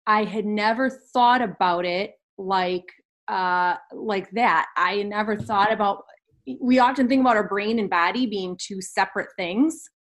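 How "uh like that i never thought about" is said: ordinary talk, nothing marked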